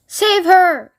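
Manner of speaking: high, loud desperate plea; a mother begging for help; urgent frightened cry; cried. A narrator's reading. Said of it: "cried Missus Beauregard".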